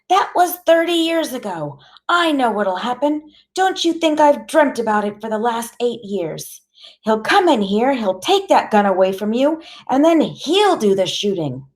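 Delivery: high-pitched, upset warning; big tearful voice. A narrator's reading. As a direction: plead urgently, dreading what will happen. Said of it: "her voice is big and full of tears".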